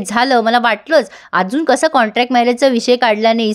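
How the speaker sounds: ordinary delivery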